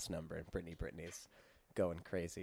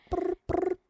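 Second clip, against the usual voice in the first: phone ringing; 3 dB louder than usual. Pretend. Mimicking phone ring.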